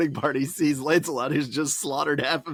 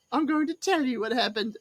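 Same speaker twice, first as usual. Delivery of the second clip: high put-on voice; Herbert telling his tale; 8 semitones higher than usual. Herbert, carried back in, announces his story, high-voiced. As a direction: pitch it up, eager to tell.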